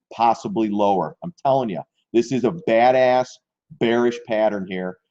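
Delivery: normal talking voice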